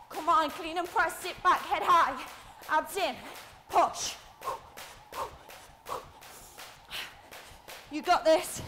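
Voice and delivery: loud, energetic workout call; urging coach. Real, not acted. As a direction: cheer them on through the set. Coach urging during exercise set.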